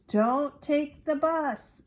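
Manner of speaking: high, loud, slow warning; loud, deliberate instruction; urgent note. Acted. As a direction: read it out emphatically, word by word.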